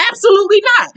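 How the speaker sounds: high-pitched imitation of backing down; hammed-up high refusal; mock indignant refusal. Acting out Jen's answer to taunt.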